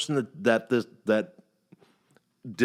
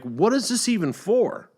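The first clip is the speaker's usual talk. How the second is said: incredulous question; 10 semitones higher than usual. Retold or imitated. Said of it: "I'm like".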